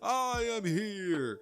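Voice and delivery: booming high hero laugh, slow; All Might. Retold or imitated. All Might arriving at the scene, booming hero voice. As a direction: make a big heroic entrance. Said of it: "All Might would end up arriving, saying".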